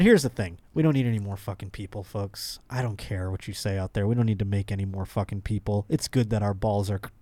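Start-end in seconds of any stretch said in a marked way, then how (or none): none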